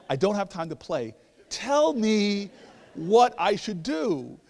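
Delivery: high, loud demand, slowing word by word; exasperated. Retold or imitated. Man demanding the answer from teasing neighbor, exasperated. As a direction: hammer out each word, out of patience.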